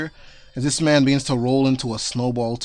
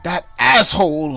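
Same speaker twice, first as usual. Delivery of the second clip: annoyed outburst; 5 semitones higher, 6 dB louder than usual. Acted. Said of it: "and he goes like".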